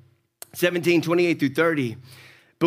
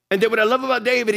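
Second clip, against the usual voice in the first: ordinary delivery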